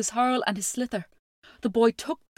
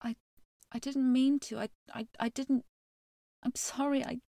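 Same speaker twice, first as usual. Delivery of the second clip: softer, halting apology on the edge of weeping; stammering, near tears; 5 semitones higher, 4 dB quieter than usual. A narrator's reading. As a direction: stumble over the words, close to crying. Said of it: "the boy began to weep as well".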